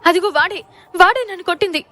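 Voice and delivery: loud, high accusing cry; loud accusing outburst. Acted. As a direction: point him out and shout it. Wife accusing, pointing him out.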